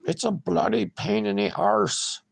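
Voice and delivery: high mock British accent. Shown as a demonstration. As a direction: lay on a bad British accent. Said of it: "shit accent, but keep trying".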